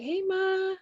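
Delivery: retold call to mom. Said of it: "So I called mommy".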